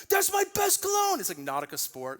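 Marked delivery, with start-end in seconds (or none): 0.1-1.2 much higher, louder mock teenage outburst; teen son yelling. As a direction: play the outraged sixteen-year-old. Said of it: "He's like".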